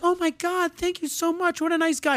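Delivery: very high mock swooning girl; grateful girl. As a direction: gush in a girlish voice. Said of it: "And she's like".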